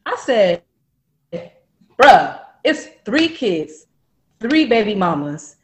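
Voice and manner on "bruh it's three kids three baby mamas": loud, emphatic telling-off; exasperated confrontation. Retold or imitated. Woman confronting boyfriend about three kids, loud exasperation. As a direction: lay it out loudly, hammering each point.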